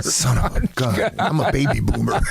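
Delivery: laughing at himself about his age. Real, not acted. Old player joking about missing out on today's money, laughing. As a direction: chuckle through the words, then laugh it out.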